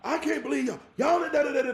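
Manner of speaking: high, loud scolding mother; a mother losing it at her kids; furious mama yelling at the kids; mama fussing. Retold or imitated. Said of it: "your mama is wilding out".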